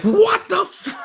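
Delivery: very high-pitched cut-off yell; high mock scream of pain; acted scream. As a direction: scream it out in shock, breaking off mid-word. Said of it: "he's gonna scream".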